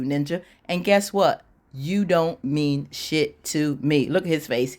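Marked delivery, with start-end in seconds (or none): 1.7-4.1 slow, word-by-word dismissal; deliberate kiss-off. Retold or imitated. Woman telling off her cheating boyfriend, slow and deliberate. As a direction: spell it out slowly for him, acting her contempt.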